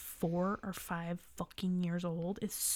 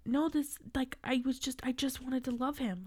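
higher, earnest protest; pleading younger self; 5 semitones higher than usual. Retold or imitated. Girl who only wanted to love her bird, earnestly pleading. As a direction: imitate a child insisting on innocence, pitched up.

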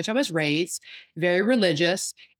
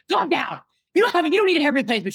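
louder, higher, fast and annoyed; annoyed friend; 9 semitones higher, 5 dB louder, faster than usual. Retold or imitated. Physical therapist friend cutting off her patient's panic, annoyed and fast. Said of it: "she was getting annoyed with me".

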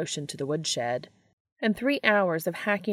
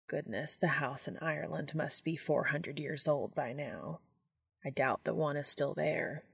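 musing angel voice; 3 semitones lower, 9 dB quieter than usual. Acted. Castiel recalling his old house in Ireland, lower and soft. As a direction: lower and softer, distant remembering.

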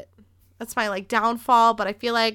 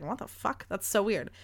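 much softer, muttered to herself; inner thought; 2 semitones lower, 9 dB quieter than usual. Retold or imitated. Inside her head at the awkward viewing, hushed and disbelieving. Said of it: "I just want you to know in my head".

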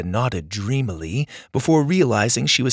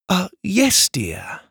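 Ma's voice; 8 semitones higher, slower than usual. Acted. Ma answers a question.

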